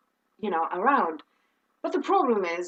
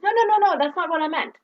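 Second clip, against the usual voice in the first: quick, raised-pitch backpedalling; hasty correction; 6 semitones higher, 4 dB louder, faster than usual. Retold or imitated. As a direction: cut in fast to clear up a misunderstanding. Director hastily corrects a misunderstanding.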